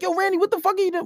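high, fast, incredulous question; alarmed disbelief. Retold or imitated. Friends to Randy doing wild stuff, alarmed and high. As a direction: pitch up and rush the question.

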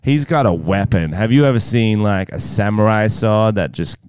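lightly voiced American girlfriend, lingering on words; American girlfriend impression. Pretending to be own girlfriend discussing the boyfriend, light American voice. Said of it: "he has to impersonate his mysterious American girlfriend".

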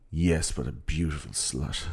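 slow, hushed mock-romantic admission; smitten reply. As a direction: imitate a smitten man owning up. Acted smitten reply to teasing.

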